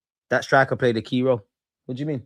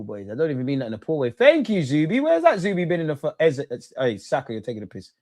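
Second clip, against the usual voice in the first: normal talking voice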